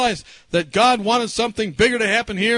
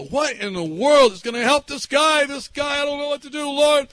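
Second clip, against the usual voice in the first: high, emphatic plea, slowing on key words; retold fervent prayer; 6 semitones higher than usual. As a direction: plead your case urgently to the Lord. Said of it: "I was praying that".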